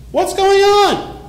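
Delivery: preacher's lifted rhetorical question; searching question. Acted. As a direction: stretch the question out high and pleading. Preacher repeats the question, slow and high.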